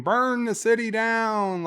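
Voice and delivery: imitated rallying yell, pitched high; mock yell. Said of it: "like, yelling".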